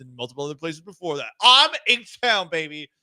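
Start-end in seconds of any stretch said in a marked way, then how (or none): 1.4-2.9 loud, high hometown shout; hype boast. Real, not acted. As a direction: shout your hometown pride.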